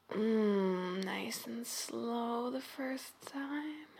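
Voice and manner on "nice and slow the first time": drawn out and softer; slow and soft. Acted. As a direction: stretch each word, slowly. Slow teasing instruction.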